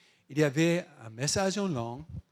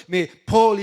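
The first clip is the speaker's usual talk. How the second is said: normal talking voice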